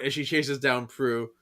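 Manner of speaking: usual speaking voice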